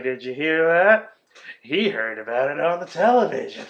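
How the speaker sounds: mock fed-up, sarcastic father; Jack Nicholson. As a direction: parrot the child's words with mock approval. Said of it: "just looking fed up with his fucking family".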